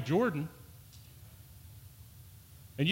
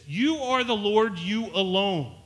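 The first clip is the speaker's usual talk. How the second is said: YOU stressed, slowed and raised; "You" pressed hard, slow and louder; emphatic quote; 3 semitones higher, 3 dB louder, slower than usual. Shown as a demonstration. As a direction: lean on "You" in "You are the Lord". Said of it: "the way they say this".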